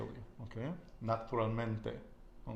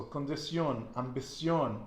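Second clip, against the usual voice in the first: "condición, ambición" punched on the last syllable, CIÓN; stress on final O; 2 semitones higher than usual. Shown as a demonstration. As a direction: lean on the ending of "condición, ambición". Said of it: "an accent on the O".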